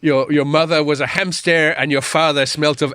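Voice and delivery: hammed-up French-accented taunt; obnoxious French bloke. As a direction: taunt them like a silly French castle guard. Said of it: "this obnoxious French bloke at the top".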